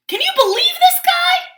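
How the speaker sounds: very high, loud cartoon disbelief; exasperated Pigeon. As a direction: appeal to the readers in outrage. Pigeon talks to readers, exasperated.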